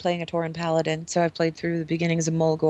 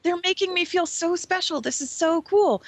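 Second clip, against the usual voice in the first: much higher, thrilled sidekick voice; giddy buddy; 10 semitones higher than usual. Retold or imitated. Little buddy bragging about feeling special, giddy and high. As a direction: gush like an overexcited kid. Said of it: "he's like".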